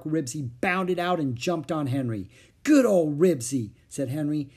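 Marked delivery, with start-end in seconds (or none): 2.6-3.7 loud happy greeting, slow; joyful. A narrator's reading. Boy greeting his freed dog, joyful and loud. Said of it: "With a joyous bark".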